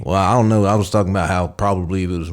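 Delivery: usual speaking voice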